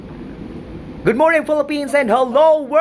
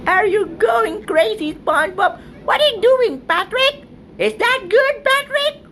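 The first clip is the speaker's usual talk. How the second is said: very high, squeaky cartoon voice; high-pitched cartoon kid voice; SpongeBob-style squeak; 10 semitones higher than usual. Squeaky cartoon voice addressing friends.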